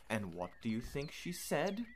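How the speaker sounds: ordinary delivery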